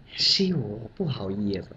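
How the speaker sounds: low, soft, deferential; humble subordinate. Retold or imitated. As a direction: say it humbly and quietly. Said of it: "小张说：".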